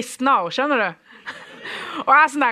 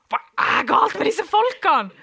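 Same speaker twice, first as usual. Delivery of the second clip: bewildered; 8 semitones higher than usual. Retold or imitated. Bewildered at charismatic church.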